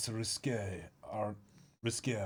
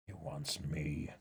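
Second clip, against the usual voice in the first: much lower and softer, a worried, solemn figure; a deep, hushed voice weighing a desperate plan; low, grave character voice; 8 semitones lower, 7 dB quieter, slower than usual. Acted. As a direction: speak low and grim about a hopeless mission.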